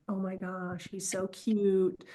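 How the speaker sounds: hushed, very soft private gush; secret inner swooning. Retold or imitated. Woman privately admitting her crush on him, secret swooning. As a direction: breathe out your admiration slowly, under the voice. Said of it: "But inside, I'm like".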